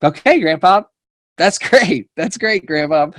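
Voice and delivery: high, laughing okay grandpa; amused indulgence. Retold or imitated. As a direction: humor grandpa through a laugh. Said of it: "And I'm like".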